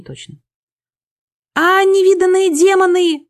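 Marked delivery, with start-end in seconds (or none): 1.5-3.3 high, loud panicked squeal; shrieking. A narrator's reading. Frightened little man sees demons, shrieking. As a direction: shriek it in alarm. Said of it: "заверещал человек".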